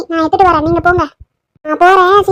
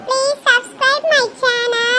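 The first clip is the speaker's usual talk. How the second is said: higher, bright host greeting to viewers; cheery channel intro; 9 semitones higher, 6 dB quieter, much slower than usual. Acted. Host greets viewers in an upbeat intro voice. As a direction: welcome the friends like a cartoon host.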